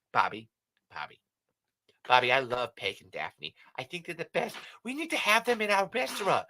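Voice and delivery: excitable cartoon wife impression; Linda Belcher. Wife begging husband for guests in restaurant, excitable impression. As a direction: climb high as you plead with your husband. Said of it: "It's Linda".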